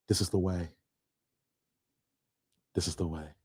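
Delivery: low, soft Mandalorian-style intone; deep hushed catchphrase. Acted. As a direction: drop the voice low and solemn for the catchphrase.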